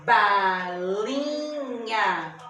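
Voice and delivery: ba-li-nha, very slow, held beats; split into syllables. Shown as a demonstration. Teacher giving a three-syllable example, very slowly sounded out. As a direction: stretch balinha syllable by syllable. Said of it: "Tem vários exemplos de trissílabas".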